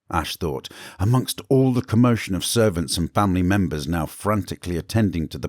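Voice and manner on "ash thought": usual speaking voice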